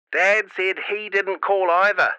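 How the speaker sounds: mum through a crackly walkie-talkie; easy denial over radio static; scratchy radio voice. A narrator's reading. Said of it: "the scratchy voice".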